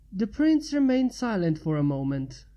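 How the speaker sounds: ordinary delivery, nothing marked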